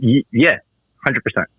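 words between sips of coffee; while sipping a drink. Real, not acted. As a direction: talk right after a sip of coffee. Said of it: "Sorry, I'm drinking some coffee".

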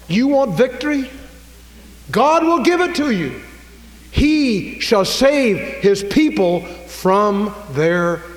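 high, loud pulpit proclamation; full-force preaching. Real, not acted. Preacher promising the congregation victory over sin, full-force preaching. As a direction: cry out the promise, stretching the verse slowly.

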